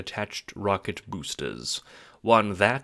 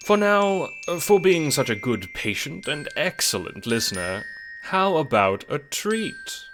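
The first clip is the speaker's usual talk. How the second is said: coaxing treat; 6 semitones higher, 3 dB louder than usual. Acted. Narrator coaxing like to a child.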